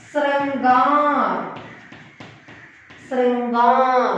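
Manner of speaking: ശൃംഗാർ slowly, with a long held last vowel; model pronunciation. Shown as a demonstration. Teacher models ശൃംഗാർ for the class, slow careful pronunciation. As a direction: stretch ശൃംഗാർ out for learners to copy. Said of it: "correct pronunciation".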